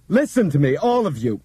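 high, loud shout into the intercom. Acted. Bob shouting to passengers over intercom, loud shout. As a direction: yell it at everyone. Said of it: "Bob shouted".

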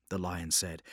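ordinary delivery

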